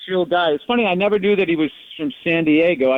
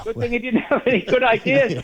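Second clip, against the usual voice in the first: lightly voiced high aristocrat, higher; mock Kennedy voice; 6 semitones higher than usual. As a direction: repeat the joke as a posh Kennedy. Repeats the joke in a voice.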